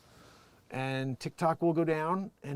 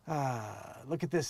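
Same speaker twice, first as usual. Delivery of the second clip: ordinary delivery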